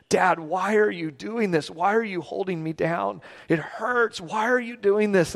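a hint of a distressed little boy; pleading child. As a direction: plead with dad, without full crying. Acts out crying child pleading.

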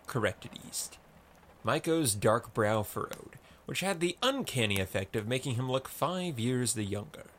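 plain reading voice

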